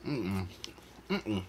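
chewing while humming; talking with food in mouth; mouth full. Real, not acted. Seen 2: bites burger at -1, cheeks full and chewing through 4.8.

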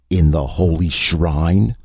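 shocked exclamation. A narrator's reading. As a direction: draw the words out in disbelief.

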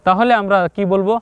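normal talking voice

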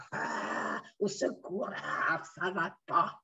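low, soft, groaning plea for help; hammed-up low moan of misery; mock distress. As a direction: act out feeling dreadful, moaning softly. Acts out being unwell.

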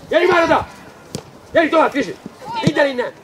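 high, insistent sideline calls; shouted coaching, pitched well up; urging shouts. Real, not acted. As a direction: drive the players on from afar. Urging players on during play.

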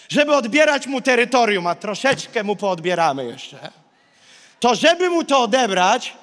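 normal talking voice

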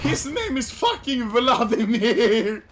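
slow, stretched-out cry with laughter in it; milk the name VLADIMIR, holding the last syllable; laughing, long-held delivery of VLADIMIR; drawn-out exclamation. Real, not acted.

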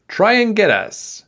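high, teasing call; calling out a taunt. A narrator's reading. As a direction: call out a dare to the dragonfly. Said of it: "he called to the dragonfly".

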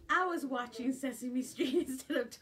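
loud, laughing confession. Real, not acted. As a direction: laugh through the admission. Laughing at herself.

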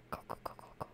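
hushed urgent whisper; whispering to run. Real, not acted. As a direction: whisper go go go while fleeing. Whispering while fleeing.

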